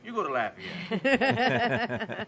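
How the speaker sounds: slow, laughing punchline; laughing drawn-out. Real, not acted. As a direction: stretch it out through a laugh. Party town punchline, laughing.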